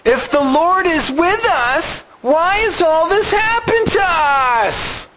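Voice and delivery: high, slow whining complaint; whiny voice. Pretend. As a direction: whine it like a sulky kid. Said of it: "you gotta put that whiny voice on".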